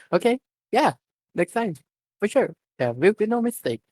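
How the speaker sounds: chirpy vow to do better; determined girl. A narrator's reading. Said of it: "determination filled her eyes".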